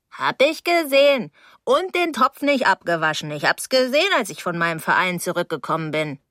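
put-on reproachful older neighbour. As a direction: nag with pointed reproach. Voices the reproachful landlady.

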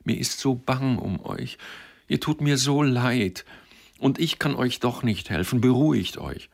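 tearful pleading. A narrator's reading. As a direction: beg him anxiously, voice lifted high. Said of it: "sie so weinend vor sich stehen sah".

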